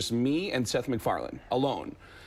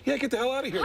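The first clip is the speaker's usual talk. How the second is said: higher-pitched retold brush-off; mock dismissive; 11 semitones higher, faster than usual. Studio boss sending the new actor away, mock dismissive. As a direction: shoo them out, lightly acted.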